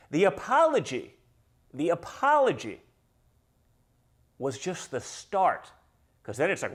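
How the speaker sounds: usual speaking voice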